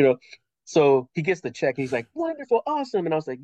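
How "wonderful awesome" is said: high-pitched thrilled approval; delighted kid. Retold or imitated. Son getting his first check, high-pitched delight.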